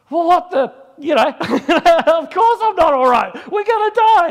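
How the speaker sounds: high, loud, half-laughing panic; panicking that the plane will crash; panicked outburst. Retold or imitated. Frightened Kiwi passenger to the narrator, high loud panic.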